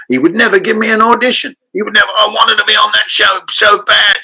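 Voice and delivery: high-pitched griping Cockney actor; Bob Hoskins. Retold or imitated. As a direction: imitate a grumbling Cockney, pitched well up. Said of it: "And he said".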